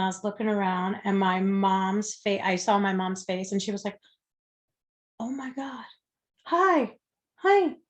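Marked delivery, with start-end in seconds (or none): 5.2-7.8 hushed gasp rising to high, loud hi; shocked mom. Retold or imitated. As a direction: imitate a stunned mother, pitch leaping up. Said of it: "she was just like Shock".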